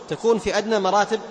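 ordinary talk

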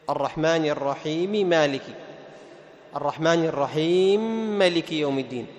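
"الرحيم مالك" read with the two m's fused into one doubled m; merged mim. Shown as a demonstration. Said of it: "كيف يعمل؟ يسكن الميم الأولى ثم يدغمها".

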